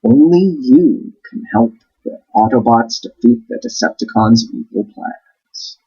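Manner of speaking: usual speaking voice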